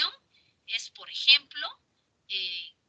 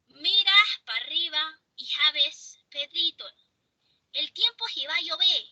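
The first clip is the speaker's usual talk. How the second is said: a light coastal Chincha lilt on "Mira pa' arriba"; chinchano accent; 4 semitones higher than usual. Retold or imitated. Teacher showing a Chincha accent to students, lightly voiced. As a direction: sing it lightly like someone from Chincha. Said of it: "Puede ser un chinchanito".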